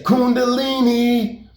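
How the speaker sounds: high, slow answering holler; drawn-out call. Retold or imitated. Country calls back up from his scooter, high drawn-out call. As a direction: imitate a friend calling back, syllables stretched.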